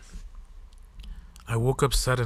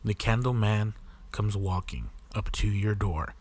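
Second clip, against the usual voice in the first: nursery rhyme; 9 dB louder than usual. A narrator's reading.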